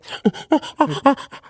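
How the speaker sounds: high-pitched breathy ape hoots; chimpanzee impression. Shown as a demonstration. Actor demonstrates their chimpanzee act on a podcast, chimp hooting. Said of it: "I can act like a chimpanzee".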